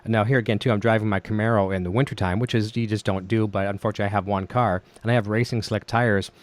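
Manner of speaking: ordinary talk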